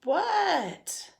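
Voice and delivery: high, drawn-out falling shout; calling back. A narrator's reading. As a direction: answer a parent's call with a long high holler. Froggy answers dad's call.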